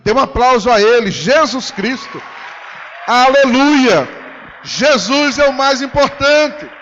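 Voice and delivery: loud, drawn-out praise call; preaching at full force. Acted. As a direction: lift the crowd into glory. (louvor em voz alta e arrastada)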